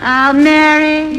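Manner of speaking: swooning. Acted. Slow swooning call.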